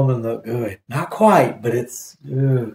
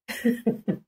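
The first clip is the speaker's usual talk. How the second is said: high laugh; 10 semitones higher, 10 dB quieter than usual. Real, not acted. Helpless laughter at bad show.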